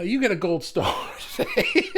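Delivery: high squealing laugh. Real, not acted. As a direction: giggle through the praise. Praising a commenter playfully.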